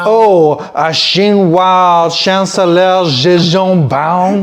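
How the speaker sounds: loud, slow, hammed-up French pomp; thick stupid French accent. Clowning as a French newsreader naming the chancellor, thick French accent. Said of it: "really thick, stupid French accent".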